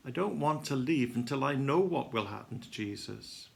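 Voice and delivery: ordinary delivery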